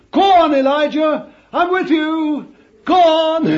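God urging Elijah on, fervently; encouraging. Retold or imitated. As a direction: spur him on with fervour.

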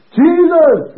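very high, loud cry; full-force shout for the whole ward to hear; retold shout. Preacher crying out over a sick man, high shout. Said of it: "And I shouted".

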